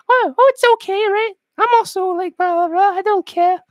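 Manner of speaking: high falsetto mock woman; reassuring woman. Woman telling him distancing is fine, high mock falsetto. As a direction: brush it off in falsetto.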